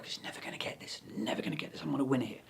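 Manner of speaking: whispered smug aside; smug under-the-breath whisper; gloating whisper. Acted. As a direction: hiss it to yourself, sure of winning. Ukulele player gloats under breath.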